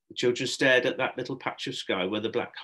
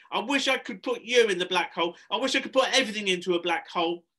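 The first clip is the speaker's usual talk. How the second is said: put-on high, loud childish rant; raised, furious boy voice; angry barking; 10 semitones higher, 5 dB louder than usual. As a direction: rail at an adult in a temper. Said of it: "Harrison barked".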